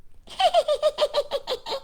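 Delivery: high mocking cackle of the robins; mocking bird laugh. Robins jeering at Toad, high mocking cackle. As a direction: cackle high at the failing kite.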